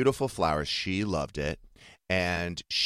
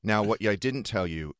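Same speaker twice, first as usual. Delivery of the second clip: usual speaking voice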